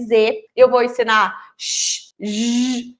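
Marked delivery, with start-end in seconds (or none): 1.6-2.1 "X" as a long shhh; voiceless hushing sh for "X"; letter sound X. Shown as a demonstration. Teacher introducing X against J, long shhh. 2.2-2.9 "J" as a long voiced zhhh; buzzing zh for "J"; letter sound J. Shown as a demonstration. Teacher introducing J against X, voiced zhhh. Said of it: "X e J".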